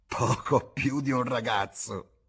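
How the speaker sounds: sneering. A narrator's reading. Bar woman mocking James's words with a slow sneer. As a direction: sneer the words back slowly. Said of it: "sogghignò".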